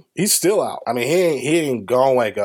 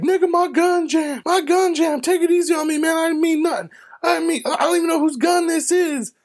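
high-pitched mock begging; panicked pleading. Gunman begging the driver to go easy, panicked. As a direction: plead high, imitating a cornered man.

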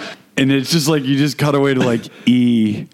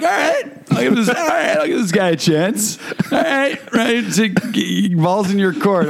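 high-pitched mock hesitant woman; high imitation of a date; imitating the date; 10 semitones higher than usual. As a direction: play a woman reluctantly giving him a chance, high voice. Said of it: "she was kinda like".

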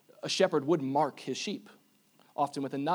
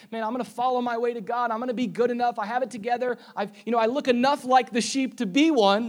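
cocky self-talk; 8 semitones higher, 8 dB louder than usual. Retold or imitated. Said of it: "place your trust in the fact that".

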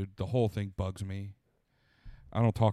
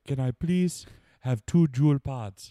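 slow put-on African accent; 2 semitones higher, much slower than usual. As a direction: order slowly in an African accent. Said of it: "If you just started doing regular African accent".

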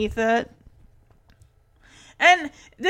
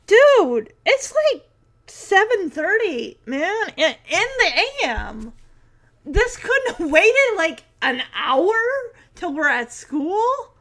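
higher-pitched exasperated protest; mock incredulity; 5 semitones higher than usual. Host reacts to Walter turning up before school, incredulous. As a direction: complain as if baffled by the early visit. Imagined complaint to early visitor.

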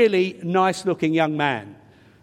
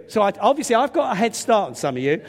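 usual speaking voice

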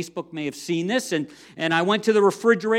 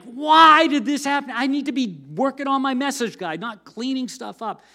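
higher and louder, a frustrated early-morning complaint; exasperated outburst; 6 semitones higher, 5 dB louder than usual. Retold or imitated. Pastor retelling his 3am kitchen rant, exasperated and higher. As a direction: vent like a pastor stuck mopping hot sauce. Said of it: "and I'm a little bit angry".

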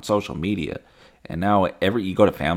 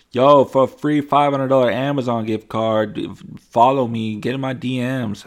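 louder, higher mock-scammer pitch; scam caption voice; 6 semitones higher, 6 dB louder than usual. Spam caption under a video, mock hype. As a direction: read it like a sketchy promoter. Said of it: "the caption's like".

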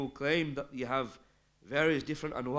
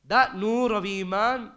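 higher, louder and slow on the light of faith; emphatic preaching; 6 semitones higher, 7 dB louder, slower than usual. Real, not acted. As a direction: hit this phrase with weight.

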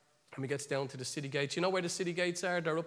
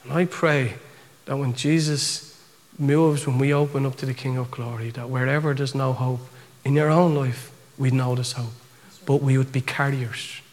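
loud, low, measured praying voice; fervent prayer; 4 semitones lower, 13 dB louder than usual. Real, not acted. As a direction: pray it out with weighty pauses. Closing prayer over the hearers.

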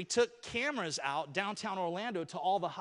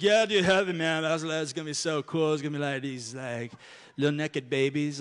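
laid-back clubgoer; 3 semitones lower, 6 dB louder than usual. Retold or imitated. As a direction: drift through it like a blissed-out partygoer. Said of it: "this one guy that we did put on there".

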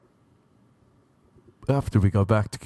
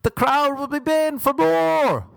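grand announcement; 7 semitones higher than usual. Retold or imitated. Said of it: "And I said".

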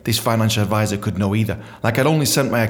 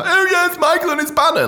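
high, loud mocking singsong; mocking jeer; 4 dB louder than usual. Coworkers ribbing Michael about the banner, in a high mocking jeer. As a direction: tease him like a smug coworker.

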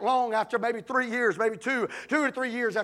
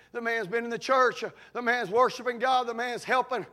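ordinary delivery

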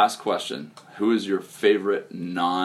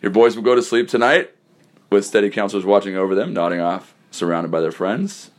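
slightly louder announcer's goodnight; radio sign-off; 4 dB louder than usual. Acted. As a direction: wrap up the show like a host.

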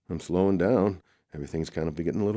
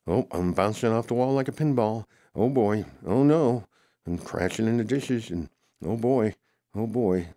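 excited yelps; 6 semitones higher, 4 dB louder than usual. Acted. Character bouncing off walls, crashing.

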